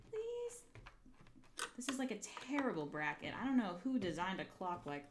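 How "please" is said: very high tiny please; high pleading. Pretend. Crafter begging the holes, pleading high. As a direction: beg in a squeak.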